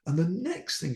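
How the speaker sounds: usual speaking voice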